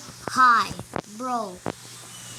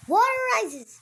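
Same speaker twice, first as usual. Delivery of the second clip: announcer voice; 11 semitones higher than usual. Pretend. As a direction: call it out like a game host. Repeats announcement.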